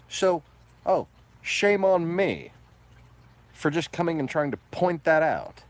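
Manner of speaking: drip scorn, pretend to apologise; loud, high sarcastic jab at the trolls; sarcastic mock shame.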